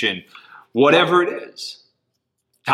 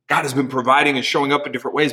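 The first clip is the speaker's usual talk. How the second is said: ordinary talk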